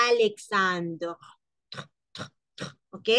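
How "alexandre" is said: teacher gives the right "Alexandre"; slow correct "Alexandre". Shown as a demonstration.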